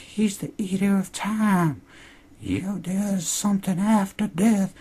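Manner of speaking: high, slow and eerie; creepy prophecy from a strange old woman; scary old lady. Retold or imitated. Podcaster mimics the scary lady's prophecy, high and slow. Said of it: "she's fucking scary in that scene".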